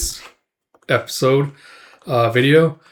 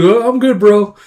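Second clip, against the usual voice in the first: high-pitched, louder brush-off; uneasy refusal; 8 semitones higher, 6 dB louder than usual. Acted. Rickard declines to join Guts, high and uneasy. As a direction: beg off in a raised, higher voice.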